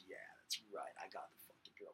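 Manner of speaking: hushed, smug whisper; whispered alpha gloat. Retold or imitated. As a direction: whisper it like a cocky alpha male. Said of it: "he'd be like".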